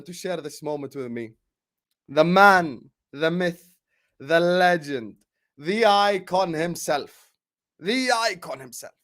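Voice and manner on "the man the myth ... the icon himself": grand slow build-up; hype announcer intro. Acted.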